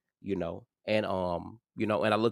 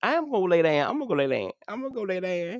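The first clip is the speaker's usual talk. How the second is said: high protesting; 11 semitones higher, 3 dB louder than usual. Real, not acted. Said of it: "I promise".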